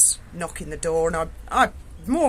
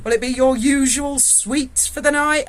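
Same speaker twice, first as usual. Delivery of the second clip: louder, slow and teasing; teasing sergeant voice, louder; jokey sergeant; 5 semitones higher, 6 dB louder, slower than usual. Retold or imitated. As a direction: play the knowing custody sergeant greeting a regular. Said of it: "And he said to me".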